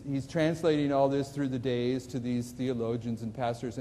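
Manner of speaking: ordinary talk, nothing marked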